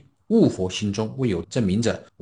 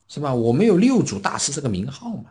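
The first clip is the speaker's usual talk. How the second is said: normal talking voice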